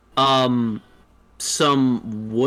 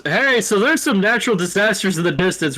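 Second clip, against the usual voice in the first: townsman calling out; 6 semitones higher, 4 dB louder, faster than usual. Acted. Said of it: "Some guy outside goes".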